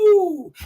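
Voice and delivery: high, falling "woo"; drawn-out wooing cry; whoop. Acted. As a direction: let out a long high whoop. Exclaims during performance.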